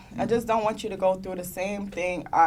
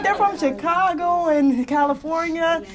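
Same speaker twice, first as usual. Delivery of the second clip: laughing quote; 7 semitones higher, 5 dB louder than usual. Retold or imitated. Mom's reply retold while laughing.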